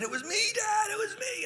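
put-on very high, soft child's squeal; small high-pitched voice, pleading; frightened young son. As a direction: confess like a scared little kid. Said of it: "my son with eyes wide open".

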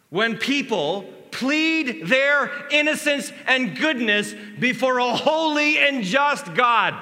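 the preacher booms at the congregation; preaching at full force. Real, not acted. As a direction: build to the judgment. Preaching climax.

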